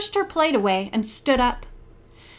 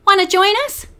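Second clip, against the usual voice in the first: much higher, cheerful invite; bright invitation; 9 semitones higher, 4 dB louder, faster than usual. Acted. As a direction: keep it bright and welcoming. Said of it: "Bess said brightly".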